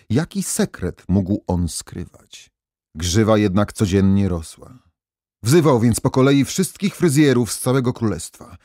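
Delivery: usual speaking voice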